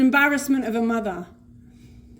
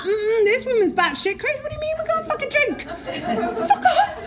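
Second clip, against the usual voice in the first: much higher, squealing through laughter; laughing disbelief; 14 semitones higher than usual. Retold or imitated. Laughing-crying at the memory.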